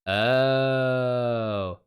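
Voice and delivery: ordinary talk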